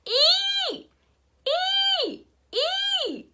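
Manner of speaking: put-on high piercing toddler screech. A narrator's reading. Toddler joining the chaos, high piercing screech. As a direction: shriek each Ee as high as possible. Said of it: "she screeched as loud as she could".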